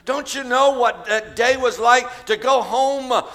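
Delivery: high, loud, driving sermon delivery; fervent preaching. Real, not acted. Preacher picturing the healed girl at dinner, impassioned.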